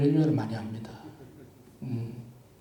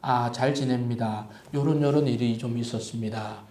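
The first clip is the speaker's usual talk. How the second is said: friendly longer answer; 7 semitones lower, 6 dB quieter than usual. Shown as a demonstration. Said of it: "요렇게 좀 하셔야 돼".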